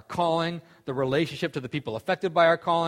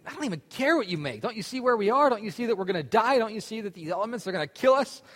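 higher, frantic pleading voice; mock panic; 8 semitones higher than usual. Stranded man panics at his calm friend, higher, mock frantic. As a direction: act out the stranded guy losing it.

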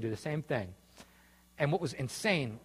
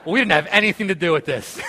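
much louder, words spilling through laughter; laughing; 5 semitones higher, 12 dB louder than usual. Real, not acted. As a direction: laugh your way through the line. Laughing through Tim's quote.

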